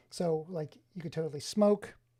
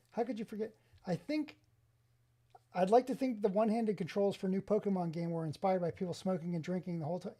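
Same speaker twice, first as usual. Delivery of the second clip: usual speaking voice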